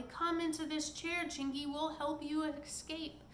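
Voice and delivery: high, urgent call to the pixie; urgent cry. Acted. Molly urges the pixie to escape, high and urgent. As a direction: hurry the pixie into the chair. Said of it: "cried Molly".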